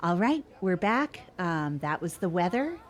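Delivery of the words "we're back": usual speaking voice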